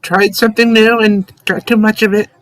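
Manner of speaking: character voice. Acted. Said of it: "We'll be like".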